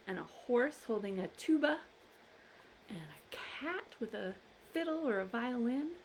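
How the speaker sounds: soft, gentle picture-book reading; reading to a toddler. Acted. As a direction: coax little listeners along softly.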